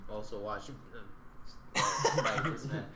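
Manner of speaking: breathless giggle while tickled; being tickled. Real, not acted. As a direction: laugh through the words, squirming. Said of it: "Adam is tickling that shit right now".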